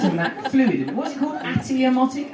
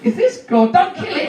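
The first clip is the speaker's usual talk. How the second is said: urgent plea; 9 semitones higher than usual. Real, not acted.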